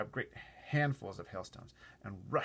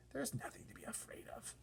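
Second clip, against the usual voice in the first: whisper; 9 dB quieter than usual. Acted. Whispered reply.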